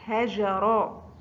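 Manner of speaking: هجرا said very slowly, each sound clear; very slow classroom model of هجرا; slow example word. Shown as a demonstration. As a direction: draw out هجرا for the pupils. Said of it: "را في آخر الكلمة".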